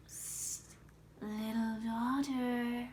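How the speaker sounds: hissed psst, then slow drawn-out words; secretive, stretched call from very near; sly cat getting her attention. Acted.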